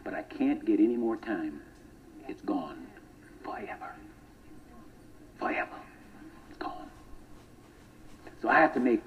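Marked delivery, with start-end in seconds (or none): 2.3-6.9 whispered, almost breathless; hushed whisper. Acted. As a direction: drop to a bare whisper for the finality. Time lost, said in hushed awe.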